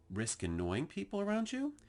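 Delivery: mock the upward question lilt; rising inflection. Shown as a demonstration. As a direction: voice climbs high at the end like a question. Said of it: "that rising inflection".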